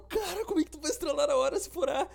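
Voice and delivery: high laughing retelling. Retold or imitated. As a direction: crack up while quoting. Friends mocking him, laughing retelling.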